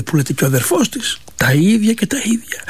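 ordinary delivery

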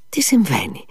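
breathless whispered question; a stunned man whispering; frightened whisper. A narrator's reading. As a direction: whisper it in shock. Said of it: "πρόφερε ο Μήτια".

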